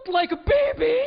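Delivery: put-on gasp, then high, loud Russian-accented protest; Russian lady. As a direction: imitate a scandalized Russian woman. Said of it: "She goes".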